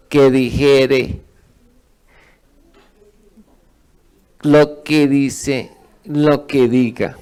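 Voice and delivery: dijere, dice and diga stretched out slowly, each word weighed and loud; preacher spelling it out word by word; slow emphatic repetition. Shown as a demonstration. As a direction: lay each phrase down slowly and firmly. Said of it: "Tres veces".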